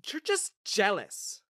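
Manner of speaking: slow, seething accusation; fuming. Acted. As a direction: spit it out slowly, seething. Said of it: "Olivia fumed".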